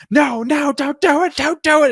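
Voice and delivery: high, loud panicked no; panicked pleading. Retold or imitated. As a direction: scream it high, begging him to stop. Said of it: "And he's like".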